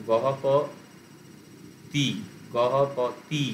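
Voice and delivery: "গহ প", pause, then "তি" on its own; split syllables. Shown as a demonstration. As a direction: set the last syllable "তি" apart from "গহ প". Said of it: "split করে করে বলতে পারো". ("গহ প ... তি" ভেঙে ভেঙে)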